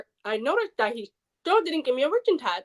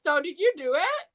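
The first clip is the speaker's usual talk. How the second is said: high mock counselor; 7 semitones higher than usual. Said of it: "She's like".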